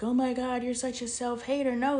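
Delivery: usual speaking voice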